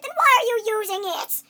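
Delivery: high, loud exasperated yell; outraged hero shouting; flipping out. Acted. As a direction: explode at the absurd excuse. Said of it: "main character of a game start flipping out".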